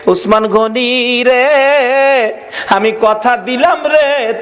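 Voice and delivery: high, slow, drawn-out chanted promise; impassioned vow. Acted. Weeping Prophet promises Usman paradise, impassioned chanted vow.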